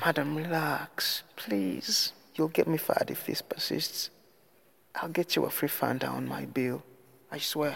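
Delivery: soft, high, anxious appeal; hushed pleading. A narrator's reading. Waiter trying to calm the customer, hushed and pleading.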